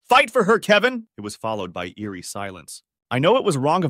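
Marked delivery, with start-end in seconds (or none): 0.0-1.1 loud, high-pitched outburst; exasperated yell. A narrator's reading. Exasperated neighbor interrupting the couple's fight, loud high yell. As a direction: butt in at full volume, high and fed up. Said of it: "that I also yelled".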